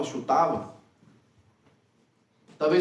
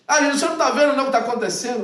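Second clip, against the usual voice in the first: higher, louder and fast, incredulous; exasperated outburst; 8 semitones higher, 6 dB louder, faster than usual. Retold or imitated. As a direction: throw up your hands, can't believe it. Cleopas exasperated at Jesus.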